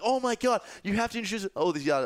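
high, fast gushing; mock excited American girl. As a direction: squeal it like a thrilled fan. Retold excited American girls.

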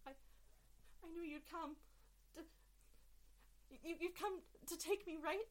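a scared Kedian hiding from its captor; frightened Kedian. Acted. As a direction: speak it as if hiding behind a door. MC voices frightened Sha'al.

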